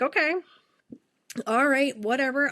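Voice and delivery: a light eye-roll of an okay; dismissive shrug. Retold or imitated. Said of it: "So I'm like".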